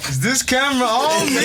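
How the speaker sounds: high-pitched, helpless laughter through the words; squeaky-high question breaking into laughter; laughing. Real, not acted. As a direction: crack up while asking it. Cracks up retelling own thought.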